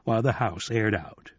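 plain reading voice

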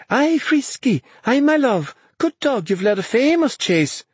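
high, delighted cheering; elated high-pitched cry; exultant praise. A narrator's reading. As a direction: praise the dog in a high, thrilled voice. Exultant praise of her dog.

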